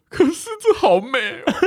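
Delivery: laughing gush. Retold or imitated. Telling her friend the wedding is beautiful, laughing gush. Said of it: "跟我朋友这样说".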